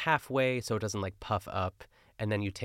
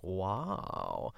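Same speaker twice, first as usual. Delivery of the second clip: mock awed wow; 4 dB quieter than usual. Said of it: "He's like".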